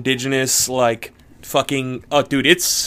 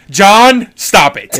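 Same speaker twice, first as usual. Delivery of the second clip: mock scolding; 6 semitones higher, 8 dB louder, slower than usual. Acting out scolding over the nickname.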